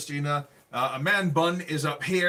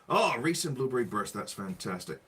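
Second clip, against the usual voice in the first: normal talking voice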